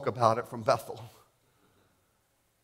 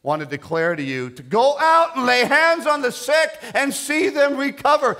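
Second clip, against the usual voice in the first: very high, loud commissioning shout; full-force preaching; 13 dB louder than usual. Real, not acted. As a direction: build from raised declaration into a sustained preacher's cry.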